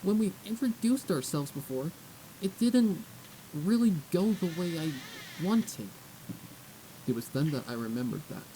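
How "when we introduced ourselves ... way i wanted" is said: put-on higher, louder young filly voice; awkward filly. Filly Twilight explaining to the guard, awkward and higher. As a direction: push through the awkward explanation.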